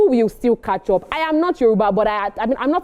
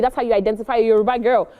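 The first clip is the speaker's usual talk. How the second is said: ordinary talk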